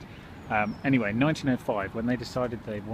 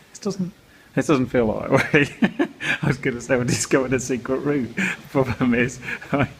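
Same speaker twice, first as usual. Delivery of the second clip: real laughing; 3 semitones higher, 7 dB louder than usual. Real, not acted. Lost vlogger admitting they ignored the signs, laughing. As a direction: laugh through admitting your own mistake. Realises they are going wrong way.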